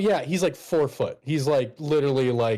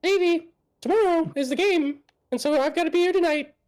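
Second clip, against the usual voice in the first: nervous nerd voice; 8 semitones higher, faster than usual. Acted. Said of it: "he's like".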